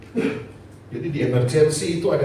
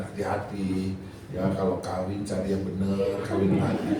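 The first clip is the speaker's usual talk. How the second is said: quiet parting advice; 7 semitones lower, 5 dB quieter than usual. Retold or imitated. Retold parting message to his wife.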